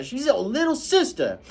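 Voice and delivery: high, loud scolding housekeeper; scolding Calpurnia. Acted. Calpurnia scolding the children on the way home. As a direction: tear into the children.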